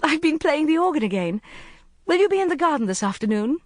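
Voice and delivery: put-on high, fast, breathless young woman calling out; eager breathless call. A narrator's reading. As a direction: call across the room, out of breath. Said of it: "calling breathlessly across the tables".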